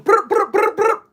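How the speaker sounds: high, loud rolled brr ad-lib; hype-man trill, loud and high; rapper ad-lib brr. Pretend.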